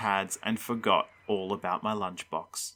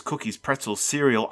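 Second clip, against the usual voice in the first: ordinary delivery, nothing marked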